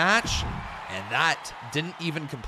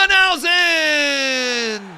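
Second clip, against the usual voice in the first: drawn-out higher yell of the name; cheering shout; 10 semitones higher, 7 dB louder, much slower than usual. Real, not acted. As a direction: cheer the winner. Cheering the winner.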